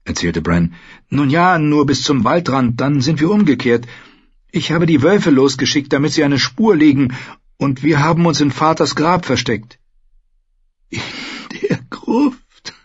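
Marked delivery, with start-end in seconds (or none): 1.1-9.8 put-on a touch higher, eager boy; young prince explaining, lightly voiced. Bran explains to the maester, lightly voiced boy. 10.9-12.7 put-on high, faint old voice; a weak amused question; dying maester's wry question. Wounded maester asks Bran, faint and amused. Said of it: "Luwin lachte leise".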